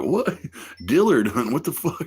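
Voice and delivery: high, incredulous what-the; squeaky disbelief at the idea; mock baffled reply. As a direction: play it stunned and confused. Own baffled reply acted out.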